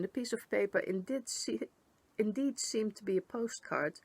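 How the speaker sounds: plain reading voice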